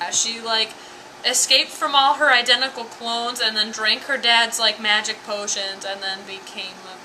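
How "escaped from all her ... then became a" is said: ordinary delivery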